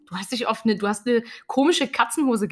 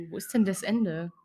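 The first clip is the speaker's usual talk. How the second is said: ordinary delivery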